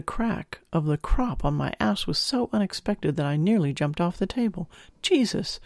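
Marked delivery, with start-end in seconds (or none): 5.0-5.6 sudden high-pitched exclamation of shock; startled yelp. Acted. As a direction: yelp it as the crop lands. Crop strikes unexpectedly.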